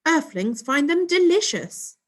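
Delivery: high, loud prim alien voice; posh alien voice. A narrator's reading. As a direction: keep up the posh alien act. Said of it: "copying Mum's posh alien voice".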